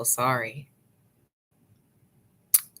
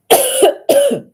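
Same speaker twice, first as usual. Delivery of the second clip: a loud, harsh choking cough that bursts out high; sudden choking cough; 13 dB louder than usual. Real, not acted. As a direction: choke and cough hard mid-sentence. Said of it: "Sorry, I about choked".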